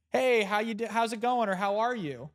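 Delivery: breezy, high-pitched mock hello; casual greeting. Greeting someone in passing, casual mock hello.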